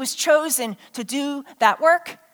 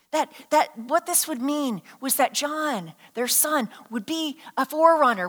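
ordinary talk, nothing marked

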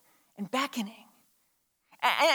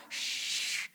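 soft drawn-out shush; 10 dB quieter than usual. Retold or imitated. Adult hushing excited kids who come in, soft shush. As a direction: shush a noisy child. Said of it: "and you're like".

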